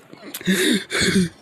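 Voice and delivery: short, high villain laugh; Shigaraki cackle. Acted. Villain laughing at the hero he insults, creepy cackle. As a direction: cackle loudly between insults. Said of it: "Shigaraki starts laughing".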